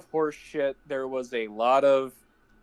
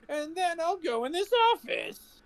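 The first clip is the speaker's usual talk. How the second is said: much higher, goofy voice; mock character voice; 10 semitones higher than usual. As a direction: pitch up like a cartoon dork. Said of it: "he's just like".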